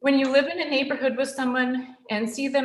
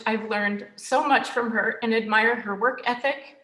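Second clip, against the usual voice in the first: ordinary talk, nothing marked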